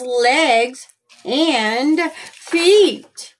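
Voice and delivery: put-on lively storybook read-aloud for little kids; reading to children. A narrator's reading. Reads picture book aloud to children.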